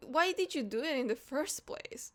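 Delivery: ordinary talk, nothing marked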